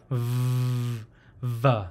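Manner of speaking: a long, drawn-out "v"; buzzing V. Shown as a demonstration. Teacher showing the buzzing sound, sustained V. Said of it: "It has a buzzing sound".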